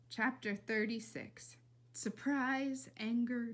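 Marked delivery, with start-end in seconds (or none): none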